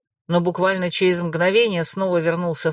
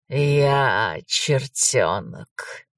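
smirking; 6 semitones lower, much slower than usual. Acted. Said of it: "усмехнулся незнакомец".

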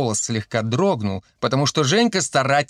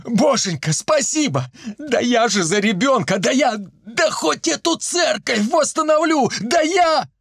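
high, breathless thanks to heaven; ecstatic joy; 10 semitones higher than usual. A narrator's reading. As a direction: gush in a high, overjoyed voice. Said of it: "заорал счастливым шёпотом Чибис, вскинув руки к небесам". (восторженно, высоким голосом)

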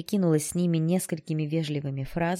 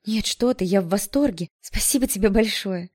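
higher, louder, effusive thanks; delighted exclamation; 4 semitones higher, 5 dB louder than usual. A narrator's reading. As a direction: burst out to reassure him. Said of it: "воскликнула я". (восторженно, с благодарностью)

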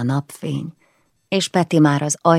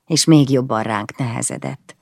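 ordinary delivery

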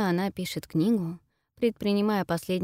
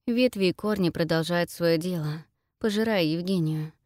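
plain reading voice

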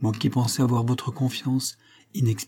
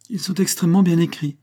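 higher-pitched, pleased self-satisfaction; complaisance; 6 semitones higher, 3 dB louder than usual. A narrator's reading. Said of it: "dit-elle avec complaisance". (avec complaisance, voix plus aiguë)

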